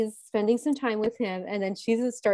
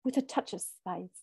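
lightly imitated British accent, lower; 3 semitones lower, 5 dB quieter than usual. Retelling the French suitor's line about spice, mild British accent. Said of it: "in his British accent".

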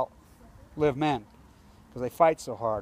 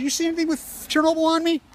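high, loud indignant outburst; much higher and louder angry reply; indignant retort; 13 semitones higher, 6 dB louder, faster than usual. Retold or imitated. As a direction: fire it back, outraged. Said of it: "I said".

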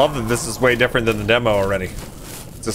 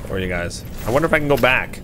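normal talking voice

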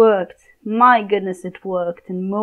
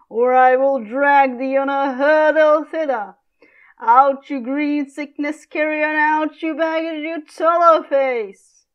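louder, higher furious father; raging Capulet; 6 semitones higher, 3 dB louder than usual. Acted. Father raging at his disobedient daughter, furious shout. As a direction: bellow insults at her.

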